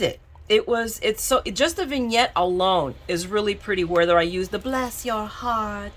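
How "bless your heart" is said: slow, softer Southern drawl; Southern accent. Shown as a demonstration. Crafter voicing the phrase she heard in her head, Southern accent. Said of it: "that's the best Southern accent I can do".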